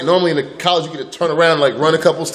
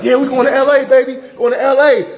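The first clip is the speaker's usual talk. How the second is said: family cheering; 9 semitones higher, 3 dB louder than usual. Retold or imitated. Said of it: "They're like".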